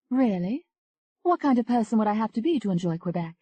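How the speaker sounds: Tony, flirty.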